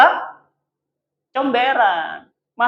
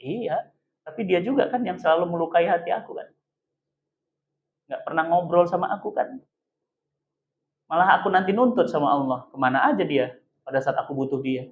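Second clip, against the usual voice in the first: low, soft reproach; quietly hurt; 3 semitones lower, 7 dB quieter than usual. Acted. Child reproaches an absent father, quietly hurt. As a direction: voice a child's old hurt, held down.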